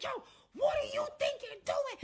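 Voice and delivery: high, loud retold shout; outraged yell. Man grabbing the girl to stop her, outraged mock yell. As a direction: holler it in acted outrage.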